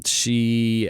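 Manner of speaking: usual speaking voice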